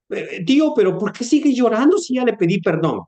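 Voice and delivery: put-on high, puzzled little girl; puzzled child. Niece to her uncle, high puzzled child voice. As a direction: ask like a confused kid.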